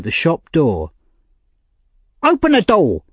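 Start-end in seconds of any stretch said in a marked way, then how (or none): 2.2-3.0 high-pitched demanding call; crying out. A narrator's reading. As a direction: call through a shut door, pitched way up. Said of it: "cried a voice".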